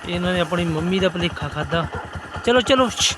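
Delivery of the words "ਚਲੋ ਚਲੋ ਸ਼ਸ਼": loud, high shoo. Real, not acted. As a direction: shoo them off loudly. Shooing puppies away.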